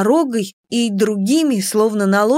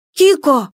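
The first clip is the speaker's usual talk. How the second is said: raised, louder call for a friend; 4 semitones higher, 5 dB louder than usual. A narrator's reading. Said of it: "позвала я". (громкий зов)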